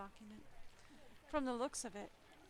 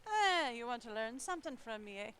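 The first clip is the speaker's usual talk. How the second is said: put-on playful Irish accent, louder; 8 dB louder than usual. Player voices the man at the café in a loud Irish accent. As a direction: lay on a hearty Irish lilt. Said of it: "I'm going very Irish for him".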